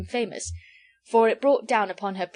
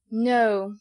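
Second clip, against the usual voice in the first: sententious; 3 semitones higher, 6 dB louder than usual. A narrator's reading. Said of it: "replied Mister Jellyband sententiously".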